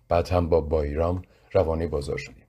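ordinary delivery

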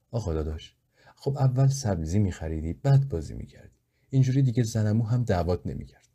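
ordinary delivery

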